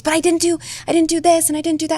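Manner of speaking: put-on a young dancer protesting her mistake; upset daughter.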